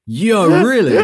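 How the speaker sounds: high, loud disbelieving yo; shocked and delighted; surprised. Real, not acted. Surprised reaction to getting a date.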